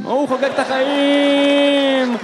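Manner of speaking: loud, drawn-out gleeful cry; hammed-up delight; exuberant celebration. Acting child celebrating in bottle cage.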